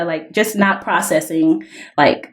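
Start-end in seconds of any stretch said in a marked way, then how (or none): none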